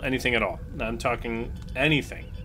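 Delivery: usual speaking voice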